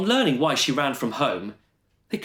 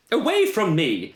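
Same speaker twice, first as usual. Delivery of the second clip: loud, higher startled yelp; 8 semitones higher, 4 dB louder than usual. Acted. The Queen yelps at the pecking swans, loud and high. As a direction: snap it out as the swans attack. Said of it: "the old Queen yelped".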